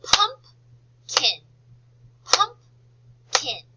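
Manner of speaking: PUMPKIN loud and high, PUMP... KIN on two beats; clapped syllables. Shown as a demonstration. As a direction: punch out PUMPKIN one clap per syllable. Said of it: "Let's clap it".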